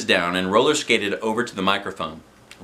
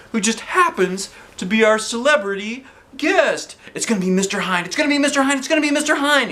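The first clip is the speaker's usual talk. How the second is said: rising higher each repeat; building hype; 9 semitones higher, faster than usual. Acted. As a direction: build the tease to a peak.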